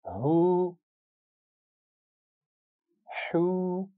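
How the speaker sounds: हू held long, the two h sounds kept apart; two distinct long hu. Shown as a demonstration. Teacher contrasts two h letters for the class: long हू. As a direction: stretch each हू and keep the h sounds distinct. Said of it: "हा और हा में फ़र्क है".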